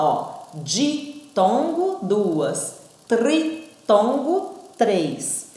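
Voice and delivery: ditongo and tritongo drawn out slowly, rising; teacher pointing out the prefixes; stressing the prefix. Shown as a demonstration. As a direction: lean on di and tri. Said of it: "A própria palavra fala".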